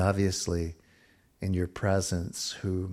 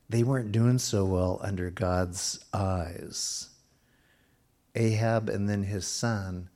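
ordinary talk

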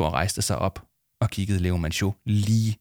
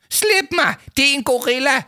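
much higher, panicked wail; 4 dB louder than usual. A narrator's reading. Adviser dangling in the air, panicked wailing. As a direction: howl to be let down. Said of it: "vrælede Leo Manchot".

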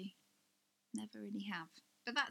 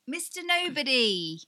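louder, higher burst as the title comes back; excited recall; 5 semitones higher, 12 dB louder than usual. Real, not acted. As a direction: blurt out "Mister Nobody" with relief. Finally remembers the film title.

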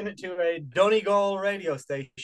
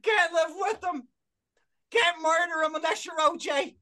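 much higher, hammed-up stand-up comic voice; a pitched-up send-up of a bad stand-up; mock hack comedian; 12 semitones higher, slower than usual. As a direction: play a cheesy club comic milking the crowd. Mock comedian bit, high voice.

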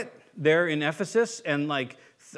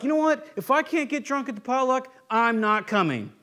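mock stubborn churchgoer; 7 semitones higher than usual.

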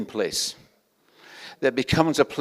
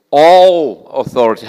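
ALL drawn out loud and slow; stress on ALL; 10 dB louder, slower than usual. Real, not acted. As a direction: proclaim it, leaning long and hard on "All". Reads scripture, stressing the word all.

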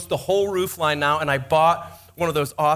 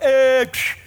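scoffing noise; 7 semitones higher, 5 dB louder than usual. Retold or imitated. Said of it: "Griswold, you're like".

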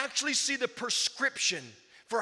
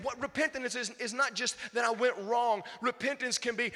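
usual speaking voice

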